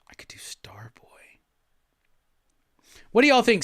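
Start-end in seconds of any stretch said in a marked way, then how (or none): 0.1-1.4 whisper. Real, not acted. Thinking aloud about song choice.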